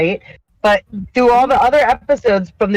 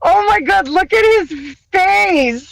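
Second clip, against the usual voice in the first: laughing disbelief; 10 semitones higher than usual. Retold or imitated. Recalling Ethan as Uncle Fester, high squealing laughter. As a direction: squeal it as if you can't stop laughing. Laughing while retelling the scene.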